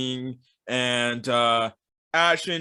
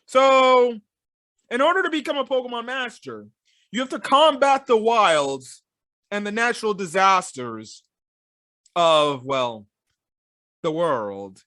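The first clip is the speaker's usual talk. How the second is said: mock grandeur; 5 semitones higher, 4 dB louder than usual. Real, not acted. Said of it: "I'm just over here like".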